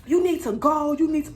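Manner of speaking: West African braider telling you to go; West African accent. Retold or imitated. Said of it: "excuse me, accent".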